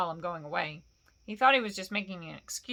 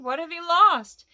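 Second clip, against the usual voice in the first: higher, loud call; raised, questioning shout; 9 semitones higher, 12 dB louder than usual. A narrator's reading. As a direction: call across to someone far off. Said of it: "Bilbo called".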